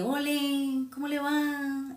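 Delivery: high, sing-song Huila greeting; Huila accent. Retold or imitated. Woman from Huila mimicking her hometown greeting, sing-song Huila accent. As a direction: greet like someone from Huila, lilting. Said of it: "tenemos como ese dialecto de".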